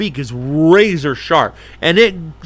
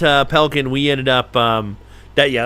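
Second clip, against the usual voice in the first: ordinary talk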